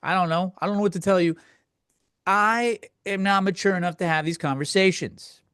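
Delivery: ordinary delivery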